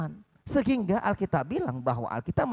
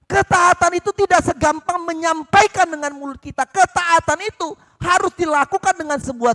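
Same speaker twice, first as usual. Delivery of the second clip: loud, high preaching climax; full-force sermon; fiery preaching; 10 semitones higher, 8 dB louder than usual. Acted. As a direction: drive the point home to the church.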